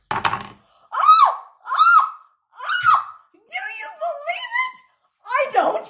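high, loud cries of disbelief; ecstatic shout. Real, not acted. Teacher shouting in joy at the last ball.

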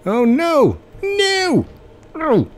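very high, loud cries of "no"; dismayed yelling. Real, not acted. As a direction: yelp in dismay at a failure. Game failure, cries out.

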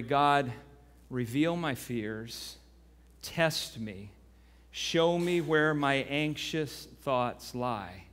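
hushed earnest prayer; quiet prayer. Acted. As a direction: pray it slowly and humbly. Leads prayer.

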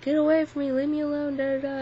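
high, loud, panicked cry to get away; frantic yell. Retold or imitated. Fighting off attackers in a dream, high panicked yelling. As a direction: shout them off you in desperation. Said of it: "I was like".

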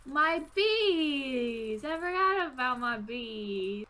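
drawn-out cry of sudden remembering; dismayed wail. Real, not acted. Player remembers neglected bees, wailing in dismay. Just reminded of forgotten bees.

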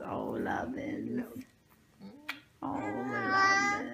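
cooing. Real, not acted. Cooing at baby.

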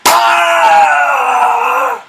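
sharp high battle yell; battle cry. Real, not acted. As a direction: shout it like landing a hit. Fighting in a game round.

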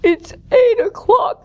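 slow, high, loud yawn through the words. Acted. Elephant settling down into his hay bed, yawning. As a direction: stretch the words out in a big yawn. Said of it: "yawned the elephant".

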